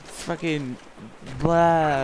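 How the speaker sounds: high mock whiny sister voice with blahs; mocking big sister. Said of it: "she's always like".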